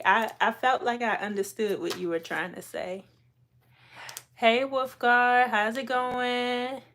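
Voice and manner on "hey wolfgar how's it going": excited greeting. Real, not acted. Greeting a new viewer loudly.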